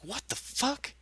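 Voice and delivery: high shocked what the fuck. Retold or imitated. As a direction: blurt it out in disbelief. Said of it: "I'm like".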